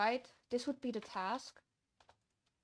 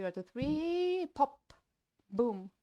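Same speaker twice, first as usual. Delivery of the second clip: playful singsong count; 4 semitones higher, 4 dB louder, slower than usual. Pretend.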